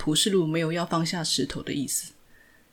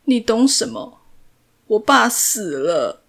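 louder, slowing to a broken cry; broken sobbing; 5 semitones higher, 8 dB louder, slower than usual. A narrator's reading. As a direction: fall apart crying. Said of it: "蒲世禄崩溃地哭着说". (崩溃地哭着说)

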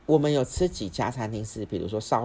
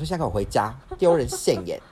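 louder, commanding scold; stern scolding; 4 semitones higher, 6 dB louder than usual. Retold or imitated. Daughter ordering mom home from the store, stern loud scold.

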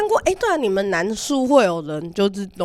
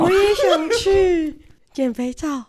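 mock whine; 8 semitones higher, much slower than usual. Jokingly wants to join in.

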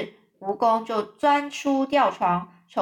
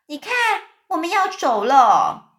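shouted warning; 6 semitones higher, 6 dB louder than usual. A narrator's reading. Spider lady cries out as the room tilts, shouting. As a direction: call it out over the lurching room. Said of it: "大声大喊了一声".

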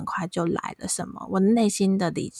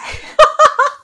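burst of laughter; 13 dB louder than usual. Real, not acted. Laughing at the memory.

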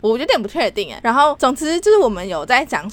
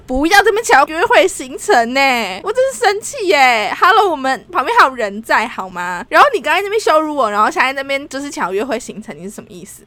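angry rant; 4 semitones higher, 5 dB louder than usual. Retold or imitated. Retelling what she said to the flirting boss, angry rant. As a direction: scold them for flirting in the meeting. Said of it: "我就说".

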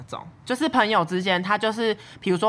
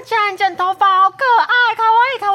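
high, loud fake-cute gushing; squealing kawaii, much higher and louder; gushing cutesy classmates; 14 semitones higher, 7 dB louder than usual. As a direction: coo it like gushing schoolgirls.

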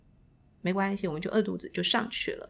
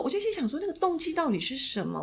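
ordinary delivery

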